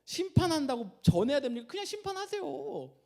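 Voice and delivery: high, loud, whining complaint to God; disgruntled protest. Acted. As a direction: act out an indignant "why me?" objection. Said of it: "요나가 딱 그 표정이에요".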